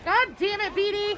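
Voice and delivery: loud annoyed outburst; frustrated yell. Real, not acted. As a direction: shout in frustration. Gamer loses a round.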